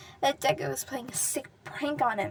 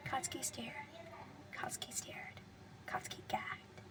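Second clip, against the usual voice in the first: hushed whisper; 11 dB quieter than usual. A narrator's reading. Staring at the corpse.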